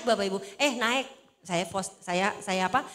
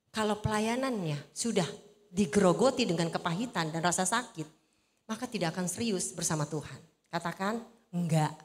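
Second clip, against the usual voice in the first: ordinary talk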